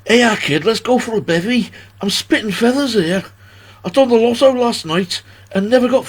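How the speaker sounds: loud, high-pitched put-on Scouse; Scouse accent. Reader attempting a poem for the viewers, exaggerated Scouse accent. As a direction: recite it as a hammed-up Scouser. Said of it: "attempt to do it in a Scouse accent".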